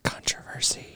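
hushed, whispered aside; mock-scandalized whisper. Mock-dramatic aside on a movie debate.

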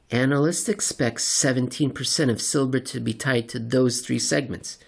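ordinary talk

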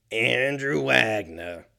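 slow, lightly put-on gruff Andrew Wagner; Robert Loggia. Shown as a demonstration. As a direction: say the name slow like a tough-guy actor. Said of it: "I sounded like fucking Robert Loggia".

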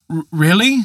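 put-on a young man too startled to speak clean; stuttering disbelief. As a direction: stumble on the first sound in shock. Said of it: "said Peter, stuttering".

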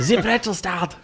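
laughing German accent. Shown as a demonstration.

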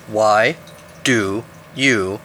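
slow, word-by-word over-enunciation; obnoxiously enunciated. A narrator's reading. Eagle demands why climber climbs, obnoxiously enunciated. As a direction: spell it out as if to a dimwit. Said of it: "enunciated in squawking obnoxiousness".

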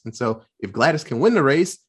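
ordinary talk

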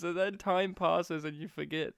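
ordinary talk

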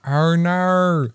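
exaggerated Australian drawn-out "no"; Australian accent. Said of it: "your Australian accent just made me think".

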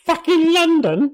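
very high, slow whiny king voice; Henry the fourth voice. Acted. Co-host joins the Henry bit, very high slow whine. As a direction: drag it out in a squeaky sulking king voice. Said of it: "same Henry the fourth voice".

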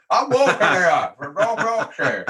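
slow, high laughing parody of the New York line; mock New York accent.

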